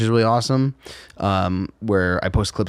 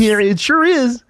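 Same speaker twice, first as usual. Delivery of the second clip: slow, high frog puppet with a laugh; laughing Muppet frog; Kermit the Frog voice; 13 semitones higher, 5 dB louder, slower than usual. Pretend. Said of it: "Was that a Kermit the Frog voice?".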